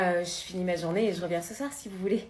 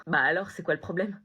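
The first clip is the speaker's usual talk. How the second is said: normal talking voice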